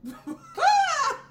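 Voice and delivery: loud, high burst of laughter; a loud, high cackle of real laughter. Real, not acted. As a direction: shriek with laughter.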